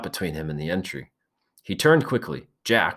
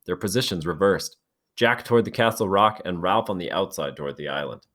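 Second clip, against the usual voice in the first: usual speaking voice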